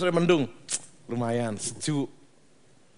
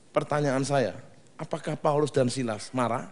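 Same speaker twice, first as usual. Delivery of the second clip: ordinary talk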